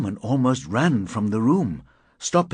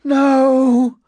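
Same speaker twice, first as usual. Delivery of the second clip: high, loud scream; long terrified cry; 8 dB louder than usual. A narrator's reading. Woman backing away from attacker, screaming. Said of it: "She was screaming".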